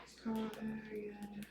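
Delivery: whispered, breathless disbelief. Real, not acted. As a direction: whisper it to yourself, stunned. Repeats the news, whispered.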